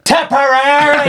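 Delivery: big bellowing chant of Tipperary, high and slow; shouted county name. Retold or imitated. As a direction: yell it like a pub rival. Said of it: "my little friend goes".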